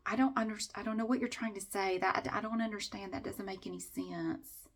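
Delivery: soft, puzzled mock-bafflement; quiet put-on bewilderment; feigned confusion. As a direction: play dumb, softly mimicking a confused company rep. Mimics company people acting confused.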